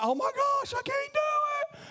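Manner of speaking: high, strained cry of despair; hammed-up high wail of giving up; mock overwhelmed panic. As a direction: act swamped and hopeless. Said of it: "you're like".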